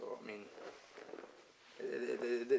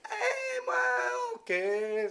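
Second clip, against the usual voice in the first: very high drawn-out whoop; mock exuberance; 4 dB louder, much slower than usual. Storyteller acting out wine being opened, mock exuberance. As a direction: whoop it high, then come down on "okay".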